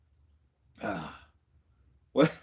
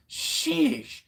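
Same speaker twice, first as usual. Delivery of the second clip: high drawn-out sheesh; excited exclaim. Real, not acted. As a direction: blow it out, still buzzing. Adrenaline rush after the chat.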